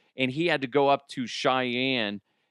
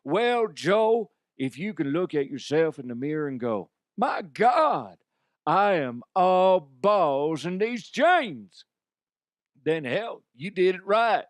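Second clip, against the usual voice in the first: cowboy voice; 4 semitones higher than usual. Retold or imitated. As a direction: play the cowboy character broadly. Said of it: "And I said".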